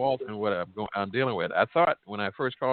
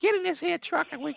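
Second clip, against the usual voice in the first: country woman voice. Retold or imitated. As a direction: call out like a country auntie. Country grandma calling from truck.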